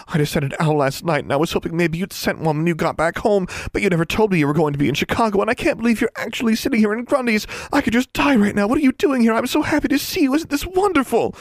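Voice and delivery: very high, fast, breathless babble; gushing in a rush. Acted. Smitten girl gushing at her crush, rushed high babble. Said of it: "said in a rush".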